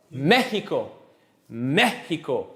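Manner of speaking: Mexico said the Spanish way, MEH-hee-ko; Mexico with the x as h, stressed up front; stress on first syllable. Shown as a demonstration. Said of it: "On the first".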